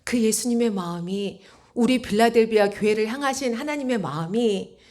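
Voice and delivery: ordinary talk, nothing marked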